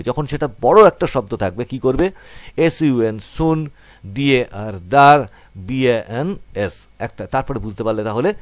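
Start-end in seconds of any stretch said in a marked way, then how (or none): 2.6-6.9 এস ইউ এন, সুন, ডি এ আর, দার spelled and joined piece by piece; syllable by syllable. Shown as a demonstration. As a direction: build the word bit by bit. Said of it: "এইভাবে ভেঙে ভেঙে উচ্চারণ করবে". (ভেঙে ভেঙে উচ্চারণ)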